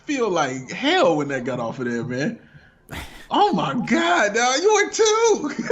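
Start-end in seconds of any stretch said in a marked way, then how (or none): 3.3-5.6 slow, high-pitched astonished cry; laughing shock. Real, not acted. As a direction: climb to a squeal of disbelief. Shocked outburst at his age.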